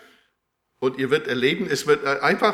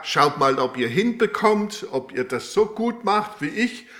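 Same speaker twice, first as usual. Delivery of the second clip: a lightly voiced, higher teasing challenge; mock challenging Jesus; 6 semitones higher, 4 dB louder than usual. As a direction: tease them like a strict examiner, pitched up. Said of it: "Jesus stellt nicht Regeln auf". (leicht gespielte, höhere spöttische Herausforderung)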